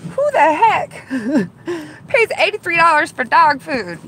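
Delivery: loud, high disbelief with a laugh; laughing in disbelief at the price; incredulous. Retold or imitated. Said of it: "and I'm thinking".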